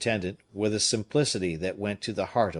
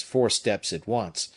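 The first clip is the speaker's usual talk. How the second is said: ordinary delivery, nothing marked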